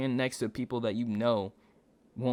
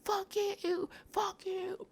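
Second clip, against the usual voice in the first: high, put-on voice of the screaming lady; mimicked yelling; 7 dB quieter, much slower than usual. Narrator mimics the raging driver's high yell. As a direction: squeal the curses like the angry driver. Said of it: "starts yelling, yelling".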